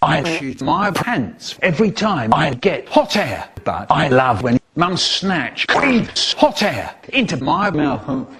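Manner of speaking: normal talking voice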